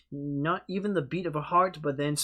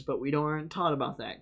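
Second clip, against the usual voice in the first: usual speaking voice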